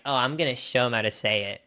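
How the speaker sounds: ordinary talk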